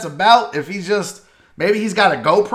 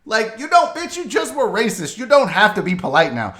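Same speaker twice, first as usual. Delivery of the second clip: usual speaking voice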